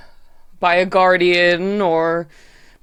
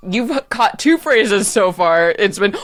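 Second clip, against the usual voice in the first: ordinary talk, nothing marked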